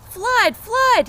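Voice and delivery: very high, loud mock warning; alarmed cry. Jack faking a flood to the birds, high loud shout. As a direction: yell it to scare the birds. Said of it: "he cried".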